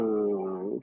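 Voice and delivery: ordinary talk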